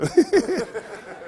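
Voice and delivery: laughing high at his own joke; a real burst of high laughter. Real, not acted.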